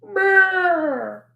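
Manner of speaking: high cat-like meow. Shown as a demonstration. Repeats the cat groan.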